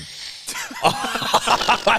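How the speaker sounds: squealing burst of laughter; high-pitched cackle of laughter. Real, not acted.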